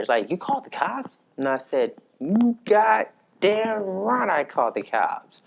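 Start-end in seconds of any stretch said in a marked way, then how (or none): 0.3-1.2 high-pitched incredulous question; puzzled, kinda mad. Retold or imitated. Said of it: "kinda mad about it". 2.2-5.4 slow, high, emphatic comeback; defiant. Retold or imitated. Defiant answer to mother's challenge.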